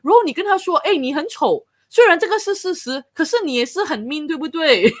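mother imitating her daughter's earnest lecture, high and loud; lecturing daughter. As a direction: put on the little girl setting mom straight. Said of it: "然后她就说".

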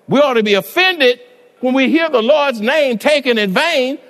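loud, high-pitched preaching; preaching at full force. Acted. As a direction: drive every phrase hard at the congregation.

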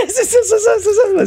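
high laughing repeats; cracking up mid-sentence. Real, not acted. Cracking up during the weighing.

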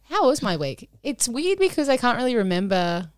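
usual speaking voice

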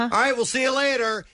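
high, breezy goodbye to hide not hearing; bright bluffing goodbye. Retold or imitated. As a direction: brush him off cheerfully. Retold bluff reply to neighbor.

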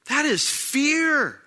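high, slow stress on fear; slow emphatic. Acted. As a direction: drag out fear with weight. Preacher hammers the point.

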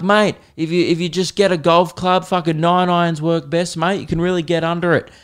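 a local advising a tourist on killing toads, mild Aussie voice; mock Aussie tip-giver. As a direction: give the golf-club tip like a keen local. Locals sending kill tips.

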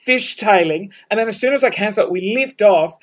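ordinary delivery

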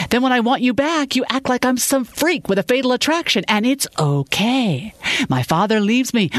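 high-pitched, hurt and angry outburst; bitter tirade. A narrator's reading. Woman listing how she has been wronged, furious and hurt. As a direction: build the accusations, dragging each bitter okay.

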